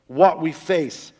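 ordinary talk

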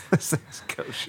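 soft breathy whisper. Real, not acted. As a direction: whisper it back. Whispered reply.

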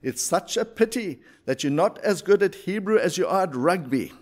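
put-on a lamenting rabbi with an Eastern European accent. Rabbi lamenting to his pupil, in an Eastern European accent. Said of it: "lament in his Eastern European accent".

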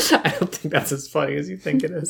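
talking through real laughter; amused, laugh spilling into the words. Real, not acted. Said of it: "I'm laughing at you thinking it's funny".

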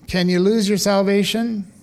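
normal talking voice

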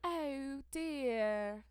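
high Southern-tinged American imitation; exaggerated attempt at American English; mock American accent. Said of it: "Trying to speak with an American accent".

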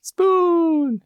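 very high, drawn-out playful yell; yelled battle cry. Pretend. Co-host showing off a catchphrase, playful high yell. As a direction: holler it like a silly hero's catchphrase. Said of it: "that means you gotta yell".

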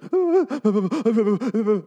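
high, loud mock sob-laugh like a child crying too hard; hammed-up gulping kid sob; imitating a crying wreck; imitated hiccup sob. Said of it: "like a little kid when they're crying so hard".

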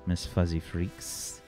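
normal talking voice

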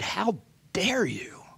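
hissed, scornful whisper of how dare you; sneering whisper. Retold or imitated. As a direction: sneer it under your breath with curled lip. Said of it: "and sneered".

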